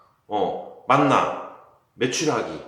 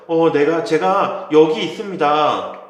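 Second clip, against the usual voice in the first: eager answer; 3 semitones higher, 7 dB louder, slower than usual. Acted. Moses answering God.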